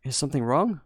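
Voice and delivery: ordinary delivery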